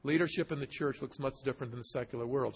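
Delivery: a hushed, rapid aside to the congregation; quiet, quick restatement. Shown as a demonstration. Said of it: "I say to us today".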